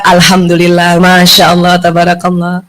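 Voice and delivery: low, humble thanks; humble gratitude. Shown as a demonstration. Taught reply to praise, low and humble. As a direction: say it low and grateful.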